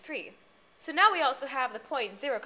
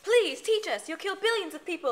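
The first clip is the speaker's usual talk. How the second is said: pleading; 6 semitones higher, 4 dB louder than usual. Acted. Pleading with the villain.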